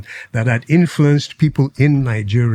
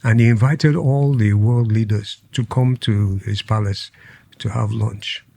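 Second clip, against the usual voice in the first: normal talking voice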